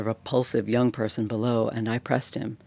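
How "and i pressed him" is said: ordinary delivery, nothing marked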